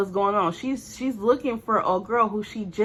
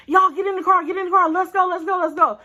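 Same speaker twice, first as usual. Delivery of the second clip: urgent hurry; 9 semitones higher, 5 dB louder, faster than usual. Retold or imitated. Friend rushing them to flee.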